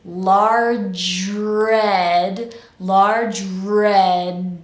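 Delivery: "large red" slow and drawn out, J running into R; linked without pause. Shown as a demonstration. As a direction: stretch LARGE RED as one connected phrase. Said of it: "do it without much of a pause".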